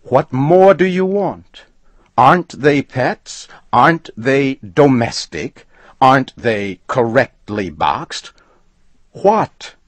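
high, loud, angry questions; furious demand. A narrator's reading. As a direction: hammer him with questions. Furious customer demands an answer.

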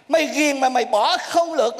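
usual speaking voice

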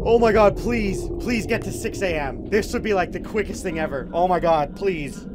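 urgent, loud begging; desperate pleading. Real, not acted. Player begging to reach six A M, frantic pleading. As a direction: plead for it under pressure.

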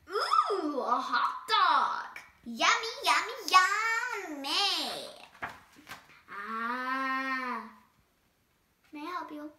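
lively picture-book read-aloud; animated storytime reading; reading to children. A narrator's reading. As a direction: read it to a room of kids.

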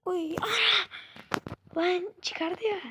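high, loud cry of frustration; wailing at the game, slowed down; a gamer's despairing howl; anguished wail. Real, not acted. Game goes wrong, frustrated.

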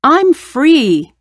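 trapped lion bursting free, yelling; joyful yell. Acted. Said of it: "yelled the lion".

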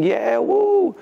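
high whooping cheer; a quick imitated party whoop; cheering crowd. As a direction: mimic the boys cheering far off. Friends cheering outside hotel.

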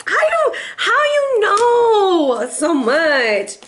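high, slow, amazed squeak; mock amazed asker. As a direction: gawk at them in disbelief, high and drawn out. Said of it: "and they're like".

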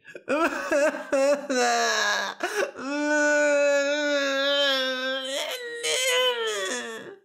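long high theatrical wailing; bawling like baby. A narrator's reading. Princess crying to get her way, hammed-up baby wail. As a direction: bawl in a hammed-up tantrum. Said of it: "burst into tears and cried like a baby".

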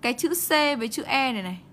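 ordinary delivery

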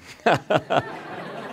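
a breathy laugh at his own sign; amused laugh. Real, not acted. Laughs while reading sign.

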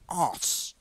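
"os", mimicking a British "arse" without the r; British-style arse. Player mimics how British guys say arse, mock British "os". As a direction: say "os" with a rounded vowel and no r. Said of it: "Whenever a British guy says arse".

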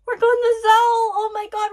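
high, loud thrilled outburst; real excitement. Real, not acted. Viewer realizing they're going to Zou, loud excitement.

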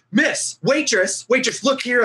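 retold yell. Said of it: "yelling at the waitress".

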